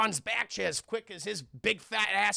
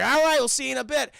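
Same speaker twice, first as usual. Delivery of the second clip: quick, high, loud send-off; breezy send-off; 6 semitones higher, 8 dB louder, faster than usual. Retold or imitated. Companions leaving the seer behind, breezy loud send-off. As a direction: call out a hurried goodbye in mock cheer.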